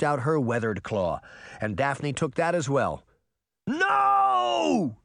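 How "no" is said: high, loud desperate no; anguished cry. A narrator's reading. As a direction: scream it too late to stop it. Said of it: "Henry cried".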